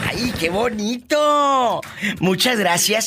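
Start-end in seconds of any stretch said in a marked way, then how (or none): 0.1-1.8 high, drawn-out cooing delight; hammed-up adoring exclamation; gushing delight. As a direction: stretch it out with theatrical pleasure. Gushes after the replayed bit.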